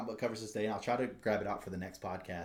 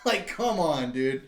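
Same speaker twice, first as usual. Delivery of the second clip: ordinary delivery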